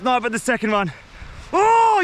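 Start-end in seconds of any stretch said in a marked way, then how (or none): 1.5-2.0 excited. Real, not acted. Overjoyed after catching fish.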